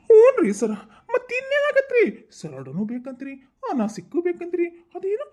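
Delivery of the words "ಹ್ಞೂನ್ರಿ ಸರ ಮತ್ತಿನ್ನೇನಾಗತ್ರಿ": high, loud exasperation; much higher, louder frustrated cry; exasperated outburst. Acted. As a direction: vent at the absurd couple. Exasperated officer.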